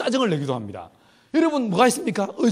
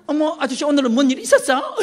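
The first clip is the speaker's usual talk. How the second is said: higher, gossipy hen asking what's wrong; fussy hen voice; 5 semitones higher, 4 dB louder than usual. Retold or imitated. Hens noticing the keeper's bad mood: higher prying hen voice. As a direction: put on a prying hen's voice. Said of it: "이러면서요".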